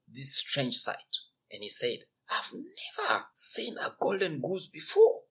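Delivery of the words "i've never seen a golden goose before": high amazed gasp; amazed farmer. Acted. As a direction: gasp it in wonder. Farmer exclaims at the goose.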